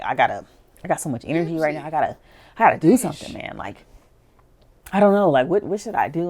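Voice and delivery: restless bursts of energy; excited. Acted. Joey with so much energy telling dad, excited. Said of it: "Joey got so excited".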